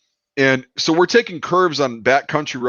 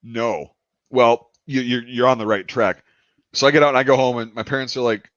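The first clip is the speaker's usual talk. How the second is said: ordinary delivery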